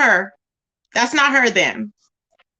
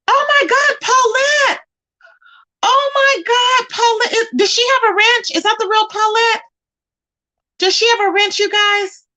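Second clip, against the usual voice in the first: excited squeal; 11 semitones higher than usual. Real, not acted. Streamer spotting a friend in chat, high excited squeal. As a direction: squeal her name with delight.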